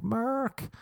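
"Merck" repeated high with an "ur" vowel; mispronounced name. Acted. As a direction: insist on "Merck", rhyming with "work". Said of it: "It's Mark".

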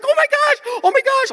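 high squealing oh my gosh; panicked woman. Retold or imitated. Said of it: "she's like".

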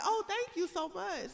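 high, drawn-out polite thank-you; mock-polite thanks. Thanking a coworker for a nail compliment, put-on politeness.

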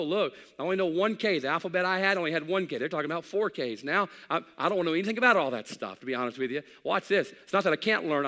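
loud, high preaching drive. Acted. Preacher telling the church he won't learn tech, loud. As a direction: push it out hard like a revival preacher.

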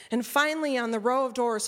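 ordinary delivery, nothing marked

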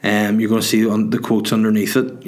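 ordinary talk

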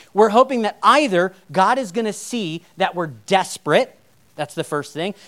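ordinary delivery

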